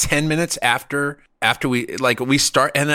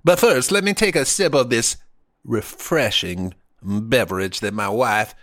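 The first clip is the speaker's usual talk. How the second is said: put-on Southern accent. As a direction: declare it like a genteel Southern host. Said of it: "He was a Southern".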